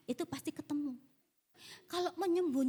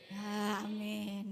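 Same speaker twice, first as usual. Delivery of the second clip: reluctant, drawn-out low amen; forced amen; 6 semitones lower, much slower than usual. Retold or imitated. Speaker to unresponsive audience, forced amen. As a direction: drag the amen out grudgingly. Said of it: "Aminnya kok terpaksa gitu".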